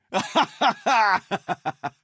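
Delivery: a drawn-out amused laugh; a long falling laugh. Acted. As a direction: laugh heartily at the farce. Laughs at wasted trouble.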